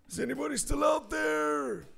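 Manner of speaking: high hopeful call into the void. Pretend. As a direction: call out high, hoping for an answer. Calling out to silent audience.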